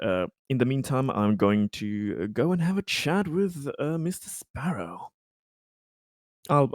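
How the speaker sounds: ordinary talk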